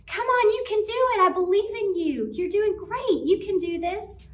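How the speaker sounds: high, loud cheering-on; encouraging friend. Acted. Friend standing beside you through a hard time, loudly encouraging. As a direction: cheer them on with rousing belief. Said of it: "that friend who's encouraging and helpful".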